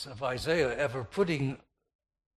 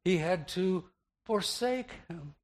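raised, louder voice with a pause before "forsake"; stress on "forsake"; 6 semitones higher, 5 dB louder than usual. Shown as a demonstration. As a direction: hold back, then hit "forsake" high. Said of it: "emphasized with the verb".

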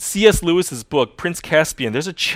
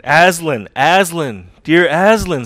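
sobbed; 4 semitones higher, 7 dB louder, slower than usual. A narrator's reading. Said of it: "sobbed Lucy".

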